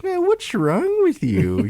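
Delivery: put-on high, slow baby-talk jab; cutesy but venomous. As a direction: imitate sweet couple talk laced with aggression. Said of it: "It's, like, cutesy, but has, has this, like, venom to it".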